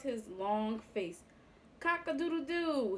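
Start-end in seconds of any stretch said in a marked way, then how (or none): 1.8-3.0 higher, snickering rooster crow. A narrator's reading. Horse finds his crow funny, snickering. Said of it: "he snickered".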